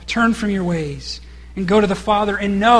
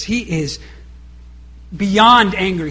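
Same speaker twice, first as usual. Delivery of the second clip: usual speaking voice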